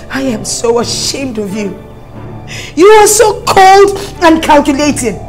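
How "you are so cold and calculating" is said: angry. Acted. Wife confronts husband.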